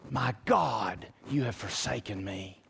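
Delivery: barely voiced, the last breath of a dying man; faint dying words. Retelling Jesus' dying words.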